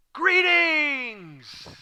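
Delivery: high, long sing-out that slides down; hammed-up, lingering high hello; drawn-out greeting. Shown as a demonstration. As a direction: hail them in a big, stretched call. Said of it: "what was the inflection? ... how did he say that?".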